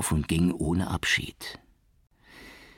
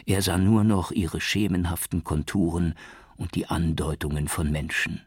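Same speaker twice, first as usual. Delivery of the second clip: usual speaking voice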